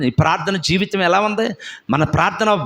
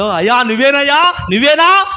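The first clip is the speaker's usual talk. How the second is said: higher, louder disciple calling across water; Peter calling out; 9 semitones higher, 7 dB louder than usual. Acted. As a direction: call out in eager disbelief.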